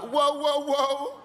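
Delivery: high, loud alarmed "whoa" with a laugh; shocked whoa. Retold or imitated. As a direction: recoil in mock alarm. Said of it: "I was like".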